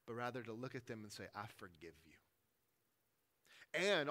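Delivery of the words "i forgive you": sincere. Shown as a demonstration. Modelled sincere forgiveness.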